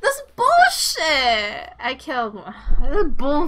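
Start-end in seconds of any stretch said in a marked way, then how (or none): 0.0-1.7 high, loud disbelief; indignant, high-pitched yelling; outraged. Real, not acted. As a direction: protest loudly at being picked on. Targeted again in game.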